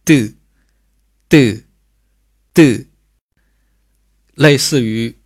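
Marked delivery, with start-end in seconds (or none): 0.1-2.8 的 as a hard, forceful tense t with falling pitch; tense consonant. Shown as a demonstration. Teacher showing students the tense sound, 的 read heavily. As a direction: punch out 的 short and heavy, like a fourth tone. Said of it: "比较重， 重读啊，嘴角呢需要用力去读".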